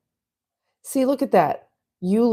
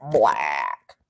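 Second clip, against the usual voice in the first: deep emphasis. Pretend. Describing his dark skin.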